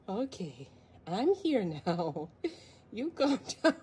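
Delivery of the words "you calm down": loud laughing tease. Real, not acted. Laughing at the kitten, telling it to calm down. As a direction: laugh while telling it to settle.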